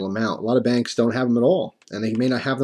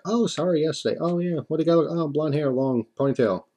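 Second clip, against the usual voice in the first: higher voiced teller and me trading lines; rapid teller exchange; 5 semitones higher than usual. Mocking the repeated bank exchange, higher voice. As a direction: rattle off the same old exchange. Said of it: "one after another".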